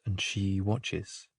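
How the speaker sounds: echoing the line as it fades out; fading hush. A narrator's reading. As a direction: let the words drift off into silence. Repeated line fades away.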